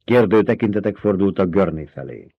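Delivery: ordinary delivery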